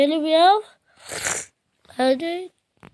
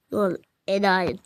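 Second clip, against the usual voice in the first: ordinary delivery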